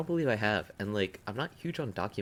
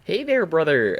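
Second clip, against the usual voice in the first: sketch character voice; 7 semitones higher, 8 dB louder than usual. Pretend. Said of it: "for all my sketch fans out there".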